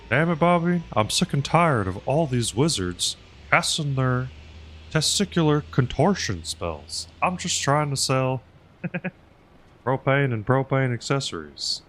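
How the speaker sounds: exasperated Texan propane salesman; Hank Hill. Retold or imitated. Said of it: "like, a Hank Hill".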